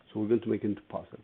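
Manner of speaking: ordinary delivery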